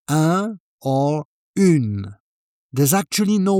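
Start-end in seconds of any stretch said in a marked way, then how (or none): 0.1-2.2 Un and une said slowly, clearly separated; careful contrast of un and une; slow French articles. Shown as a demonstration. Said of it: "either masculine or feminine".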